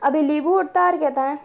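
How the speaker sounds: ordinary delivery, nothing marked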